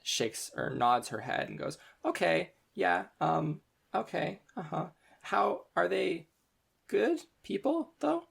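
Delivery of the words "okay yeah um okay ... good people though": high, hesitant, slowed nervous questions; anxious student. Acted. Nervous student asking if the group are good people. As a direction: gather courage and ask timidly. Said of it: "She takes a large huff in".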